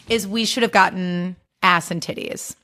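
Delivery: ordinary talk, nothing marked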